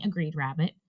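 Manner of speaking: plain reading voice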